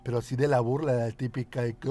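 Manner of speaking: usual speaking voice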